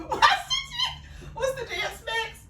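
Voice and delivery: high laughing question. Real, not acted. Caught dancing, asking through high laughter.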